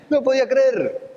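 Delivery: high, laughing through it; laughing disbelief. Real, not acted. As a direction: say it while still laughing.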